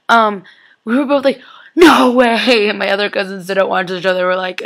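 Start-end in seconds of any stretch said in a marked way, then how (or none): 1.8-2.7 louder, drawn-out gasp of disbelief; shocked reaction. Retold or imitated. Vlogger recalling a reaction with her cousin's friend, shocked. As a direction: exclaim it in mock shock.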